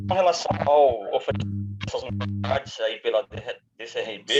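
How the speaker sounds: glitchy robotic call audio; choppy breaking-up connection; voice cutting out. Real, not acted. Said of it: "Sua voz tá cortando um pouquinho".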